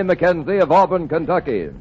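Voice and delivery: ordinary delivery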